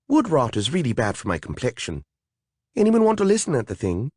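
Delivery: vain little fairy pushing the job off; fussy fairy. Acted. A fairy asking the others to listen, fussy.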